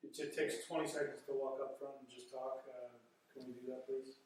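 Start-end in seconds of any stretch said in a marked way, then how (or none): none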